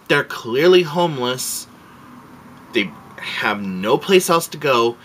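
high, exasperated challenge; retold outrage, starting high and settling; indignant pleading. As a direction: plead with an officer in disbelief. Retold confrontation with patrol officer.

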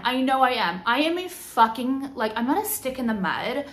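ordinary talk

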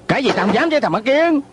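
high-pitched indignant retort; heated, bullying defiance; defiant anger. Acted. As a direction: throw the dare back, pitch jumping up. Acted quarrel, defiant retort.

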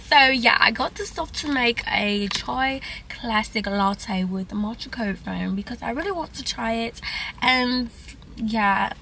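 amateur mock-British accent. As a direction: keep up a playful English accent while chatting. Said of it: "I feel like my English accent".

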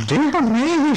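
high-pitched, fussy storybook shopkeeper; startled high character voice; Mister Rumple. Acted. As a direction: exclaim it high as the pet-store owner. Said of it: "said Mister Rumple".

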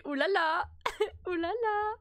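high, loud excited "ouh là là". Real, not acted. Streamer to chat, excited at the game. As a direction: gush at what's on screen.

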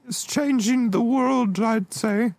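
slow, high cartoon-bear voice; Winnie the Pooh. Son proud of his invention, high Pooh-like voice. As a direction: pitch it up and take it slow. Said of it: "I sound like Winnie the Pooh".

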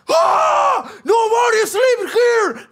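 high, loud, stretched-out shout in English. Retold or imitated. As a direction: blast him awake with a drawn-out yell. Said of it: "me dice así".

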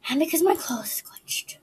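slow, secretive whisper. Real, not acted. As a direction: breathe it out as a secret. Sudden whispered aside.

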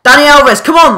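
high, loud urging yell; excited shout. Real, not acted. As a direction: scream him on toward goal. Urging player toward goal.

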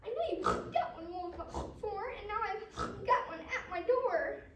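high, urgent piggy plea; worried little pig on the phone; pig's cry. Acted. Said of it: "Pig cried".

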